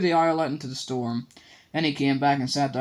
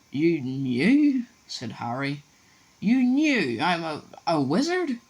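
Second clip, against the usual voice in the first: put-on higher, stunned boy's question; shocked disbelief; 4 semitones higher, 4 dB louder, much slower than usual. A narrator's reading. Harry shocked by news.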